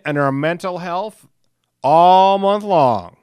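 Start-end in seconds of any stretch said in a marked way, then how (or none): 1.8-3.2 loud, slow, stretched slogan; announcer shout. Acted. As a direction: belt it out like a host. Emphatic show slogan.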